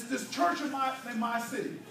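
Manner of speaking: ordinary talk, nothing marked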